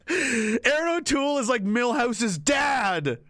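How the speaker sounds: normal talking voice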